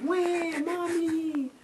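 put-on high wailing baby cry. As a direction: whine like a crying baby. Imitating a crying baby.

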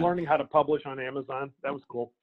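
talking while chewing breakfast; mouth full. Real, not acted. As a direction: speak around a bite of food. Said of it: "I'm eating breakfast as we talk".

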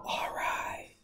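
very soft, voiceless whisper; secretive whisper. Pretend. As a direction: whisper it like a secret shared with the class. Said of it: "Why is it funny that I'm whispering?".

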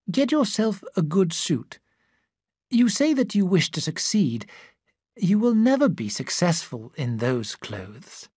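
a lightly voiced older woman, firm; stern older woman. Older woman tells Andrew to buy a suit, lightly voiced and firm. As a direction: give motherly advice, a touch stern.

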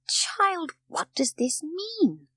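high, slow, drawn-out question; stretched-out, high-pitched disbelief; astonished questioning. A narrator's reading. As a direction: question the child in amazement. Sesemann finds Heidi downstairs at night.

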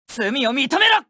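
high, fierce demand to confess; stern combat yell; commanding battle shout. Acted. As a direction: hammer the order home. Battle attack cry.

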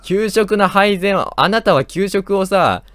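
loud, high protest at the chaser; panicked yelling. Real, not acted. Fleeing the game monster, yelling in loud protest. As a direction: yell it's not fair, running away.

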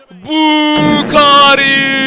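very slow, high, stretched name into laughter; elated wail breaking into laughter; 1 joyful cry. Real, not acted. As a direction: celebrate with a drawn-out cry. Player scores.

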